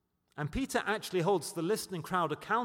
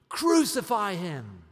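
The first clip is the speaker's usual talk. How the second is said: loud, drawn-out cry of a hostile crowd; acted crowd scream; 3 semitones higher, 9 dB louder, slower than usual. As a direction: shout it slowly like a mob demanding death.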